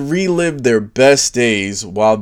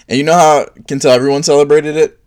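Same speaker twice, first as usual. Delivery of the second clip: ordinary talk, nothing marked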